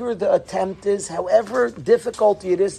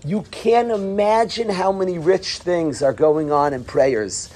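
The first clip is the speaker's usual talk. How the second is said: ordinary talk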